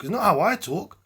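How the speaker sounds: "I" punched loud and high; stress on I. Real, not acted. Rebutting the man's excuse about talk, stress on "I". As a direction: hit the word "I" hard. Contrasts I with you.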